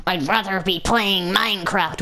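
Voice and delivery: slow, strained mock-angry grumble; hammed-up sulky fuming; fuming child. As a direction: seethe it word by word like a cross eight-year-old. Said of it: "fuming in my chair".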